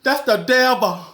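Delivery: high, loud alarmed squeal; alarmed shriek. Retold or imitated. Shrieking to her sister about the devil. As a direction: sound the alarm, voice way up. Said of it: "I was like".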